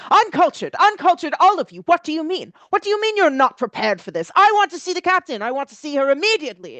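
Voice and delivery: high, fast, outraged fop; snooty dandy tantrum. Acted. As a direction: shriek like a spoiled aristocrat. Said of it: "he's storming up".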